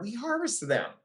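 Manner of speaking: ordinary delivery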